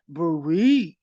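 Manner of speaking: loud robotic order; loud mechanical command; machine command. Retold or imitated. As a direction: announce it like a scanner. Voicing the machine.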